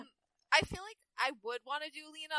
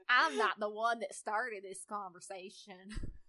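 McCree; 6 semitones lower, much slower than usual. Acted. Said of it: "and I'll be McCree".

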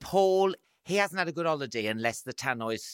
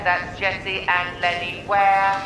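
louder, higher boarding-call announcer; flight attendant; 5 semitones higher, 5 dB louder than usual. Pretend. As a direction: call passengers over the tannoy. Mock airport boarding announcement.

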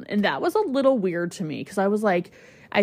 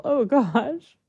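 overcome with tears; 6 semitones higher than usual. Real, not acted. Owner recalling the dog being good, overcome with tears. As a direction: voice lifts high as real tears take over. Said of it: "Did not expect to cry".

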